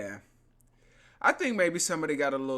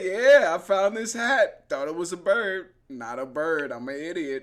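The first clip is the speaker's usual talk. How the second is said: mock dim woman; 3 semitones higher, 5 dB louder than usual. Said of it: "She's like".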